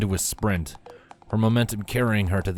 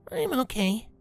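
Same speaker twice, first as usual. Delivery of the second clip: put-on much higher young filly voice, brave; a hurt kid playing it down; little filly Scootaloo. Scootaloo answers Rainbow.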